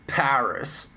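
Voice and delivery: Paris with a sounded final s; common English pronunciation. Shown as a demonstration. People defending their usage, Paris said as PARR-iss. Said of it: "what's wrong with those pronunciations".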